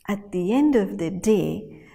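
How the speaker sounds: ordinary delivery, nothing marked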